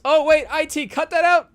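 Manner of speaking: high, loud mock alarm; mock panic. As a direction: pretend to panic over a slip. Fake panic over a slip.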